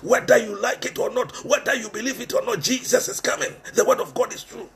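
ordinary delivery

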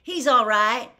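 loud, high relieved shout; relieved cry. A narrator's reading. Boy telling his sister the man is safe, loud relief. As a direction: call out the good news with relief.

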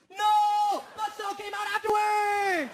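high, loud outraged protest; mock indignant yell. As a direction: shout the denial slowly like you're wronged. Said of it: "He goes".

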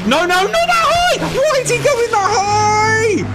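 high panicked yelling; desperate shout of alarm. Real, not acted. Plane climbing into flak, panics.

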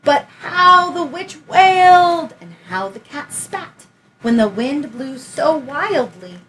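put-on lively read-aloud for a child; story narration. A narrator's reading. Teacher reading Room on the Broom aloud, lively storytelling. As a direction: tell it like a bedtime picture book. Reads picture book aloud.

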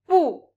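exaggerated fourth tone "Bu"; falling tone bu. Shown as a demonstration. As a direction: snap "Bu" down hard like refusing. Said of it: "slight exaggeration, like an angry no".

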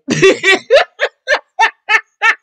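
long, high, loud laughing fit; real helpless cackling laughter; cracking up. Real, not acted. Recapper tickled by Portia's Pedialyte jab, cracking up. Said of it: "I was over here cracking up".

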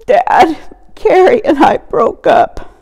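put-on high, loud teenage sobbing; a daughter sobbing to her dad over a breakup; jerky sobs. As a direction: blurt it out through choked sobs. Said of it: "In jerky sobs".

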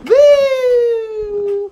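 high whooping woo; hype yell. Acted. Hype before rapping.

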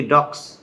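ডগস with a hissed s, as "docks"; everyday S ending. Shown as a demonstration. Teacher showing how we usually say it, voiceless ending. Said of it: "আমরা সাধারণত বলে থাকি".

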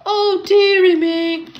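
lament, building on the repeat; storybook moan of dismay; groaning. A narrator's reading. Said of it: "groaned Thomas".

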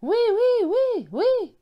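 very high, loud chorus of yeses; shouting together. A narrator's reading. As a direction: yell it like excited children. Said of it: "nous avons crié".

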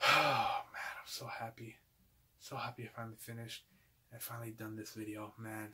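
very soft, near-whispered relief; relieved hush. Real, not acted. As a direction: sigh it out softly, spent and happy. Relieved at finishing the video.

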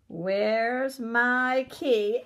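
very slow "Where's my key?" searching aloud; drawn-out searching. Shown as a demonstration. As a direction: stretch it out while looking. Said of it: "searching slowly on screen".

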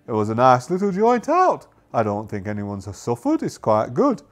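swooping sing-song pirate crewmate voice; crew member answering the captain. Acted. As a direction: play a crewmate reassuring the captain, pitch swinging up and down.

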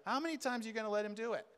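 ordinary delivery

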